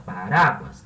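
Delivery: "Paráguas" with a long middle "rá"; teacher's demonstration of "Paráguas", strong on "rá"; stress on RA. Shown as a demonstration. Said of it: "A sílaba tônica é ra".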